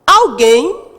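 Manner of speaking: "Alguém" loud, with the final GUÉM punched; stress on GUÉM. Shown as a demonstration. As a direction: hit the last syllable of "Alguém". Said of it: "a sílaba mais forte é o guem". ("Alguém" com o GUÉM final bem forte)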